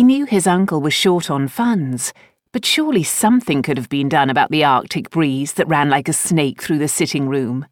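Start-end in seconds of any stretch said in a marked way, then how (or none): none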